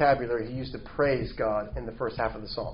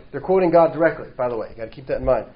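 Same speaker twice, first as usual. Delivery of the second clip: ordinary talk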